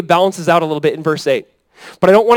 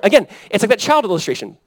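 normal talking voice

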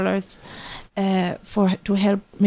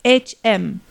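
slow, letter-by-letter spelling; spelled out; slower than usual. Shown as a demonstration. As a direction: spell it out slowly, each letter set apart. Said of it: "It's pronounced".

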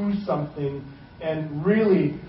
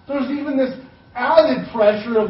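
ordinary talk, nothing marked

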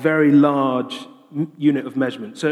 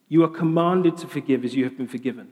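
usual speaking voice

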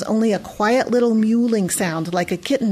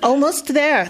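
bus driver calling out, higher; 6 semitones higher than usual. A narrator's reading. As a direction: call it out to the whole bus. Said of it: "the bus driver called out".

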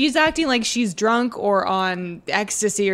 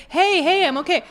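higher-pitched imitated interjection; mock calming him down; 8 semitones higher than usual. Imitating her stopping the fight, mock calming. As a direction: act out stepping in to stop him. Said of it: "she's just like".

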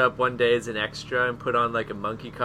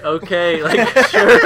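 high, loud laughing okay; laughing through words; 9 dB louder, slower than usual. Real, not acted. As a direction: crack up while saying sure. Mimicking crew, breaking into laughter.

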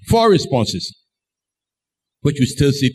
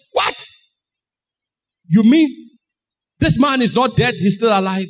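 high-pitched disbelief with a stunned pause; astonished; 6 semitones higher than usual. Acted. Newly healed man learns he's still alive, astonished. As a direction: react as if you can't believe it. Astonished reaction as scales drop off.

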